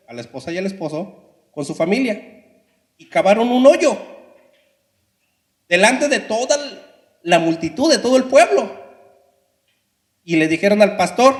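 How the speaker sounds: normal talking voice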